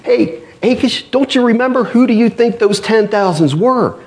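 high, taunting lords jogging the king's memory; needling Philistine lords. Retold or imitated. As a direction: poke at Achish with a sly reminder. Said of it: "And like".

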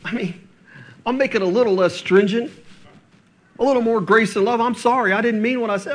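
hammed-up pleading apology; mock begging. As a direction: play a needy leader begging the crowd to come back. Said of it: "begging and pleading with the others".

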